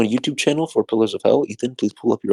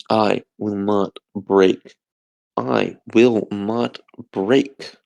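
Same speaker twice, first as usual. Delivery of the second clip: slow vow; much slower than usual. Real, not acted. Tired man repeating a vow.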